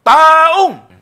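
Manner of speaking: "Taong" shouted very high and long; stress on TAONG. Shown as a demonstration. Mimicking how non-believing friends read it, TAONG over-stressed. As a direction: slam all the weight on "Taong", loud and drawn out. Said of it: "dinidiin niyan lang".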